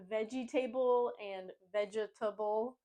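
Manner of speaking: veggie table as VEJ-ee-TAY-bull, vegetable as VEJ-uh-tuh-bull; four-syllable mispronunciations. Shown as a demonstration. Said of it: "making it four syllables".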